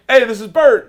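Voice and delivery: Will's intro. Retold or imitated. [name] introducing Bert to his crew, high and loud. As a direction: announce him up high and bright.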